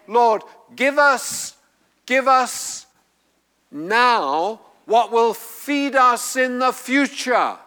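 high, slow, insistent petition; pleading prayer. Acted. Preacher voicing what the prayer says to the Lord, insistent plea. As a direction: repeat the plea with rising weight, pausing between.

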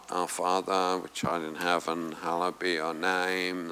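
low, quiet monotone prayer; rote recitation. Acted. Preacher mimicking how the prayer is not meant, rote monotone. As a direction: drone it softly on one low note. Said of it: "It's not".

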